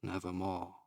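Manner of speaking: low, hushed, final; raven's reply. A narrator's reading. Raven answers the pleading narrator, low and hushed. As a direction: let the bird's word sink softly. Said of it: "Quoth the raven".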